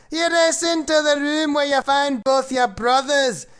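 put-on storytelling in a Scottish accent. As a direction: recite the rhyme in a Scottish accent. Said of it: "with his magnificent Scottish accent".

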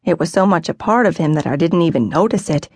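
plain reading voice

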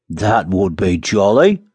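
slow, relishing exclamation; delighted cry. A narrator's reading. Miller hearing of cakes, slow delighted cry. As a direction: savour it, drawn right out. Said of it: "cried the miller".

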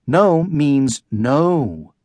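each word slow and deliberate, no means no; firm and slow. A narrator's reading. Mom refusing her son, slow and firm. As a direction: lay it down slowly, no arguing.

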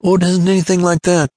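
high, quick, lightly amused reply. A narrator's reading. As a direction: brush it off with a touch of amusement. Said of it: "answered with a laugh".

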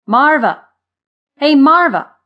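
high, loud cheerful call to a friend; chirped call. A narrator's reading. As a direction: chirp the name out brightly and loudly. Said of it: "a voice chirped as it leaped".

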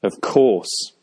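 sarcastic echo, "course" leaned on; pointedly mocking agreement; heavy sarcasm. A narrator's reading. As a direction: repeat it back dripping with sarcasm. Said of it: "I repeated with heavy sarcasm".

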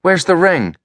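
put-on a boy pressing hard for an answer; asking. A narrator's reading. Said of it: "he asked".

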